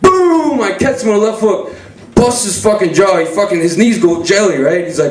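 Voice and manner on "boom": high, falling boom; imitated punch impact. His left hook landing on brother, booming impact. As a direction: let the hit ring out high.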